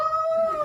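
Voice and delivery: high, theatrical gasp; mock gasp. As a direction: gasp as if proposed to. Gasp before yes.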